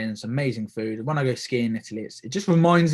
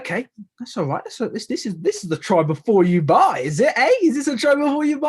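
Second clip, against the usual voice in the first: rising, louder, gleeful voice climbing high; excited exclamation; 9 semitones higher, 4 dB louder than usual. Retold or imitated. Tasting free lemonade, gleeful and loud. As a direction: play up delight at the free sample.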